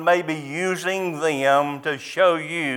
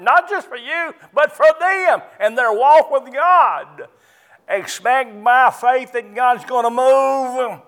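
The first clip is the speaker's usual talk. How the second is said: high, ringing pulpit exhortation; fervent preaching; 7 semitones higher, 4 dB louder than usual. Acted. Preacher pleading with his congregation, fervent and high. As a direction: preach it with rising fervor.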